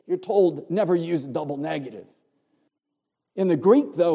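usual speaking voice

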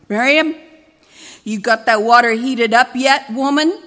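loud, gravelly barked demand; gruff demanding shout. Acted. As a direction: bark it at her, gravelly and loud. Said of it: "gravelly voice demanded".

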